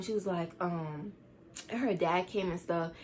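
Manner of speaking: ordinary talk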